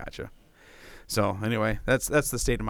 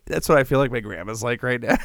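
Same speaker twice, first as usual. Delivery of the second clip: ordinary talk, nothing marked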